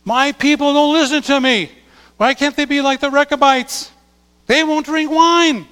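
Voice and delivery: God's lament. Acted. God lamenting his people to the congregation, high and loud. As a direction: cry out like a father let down.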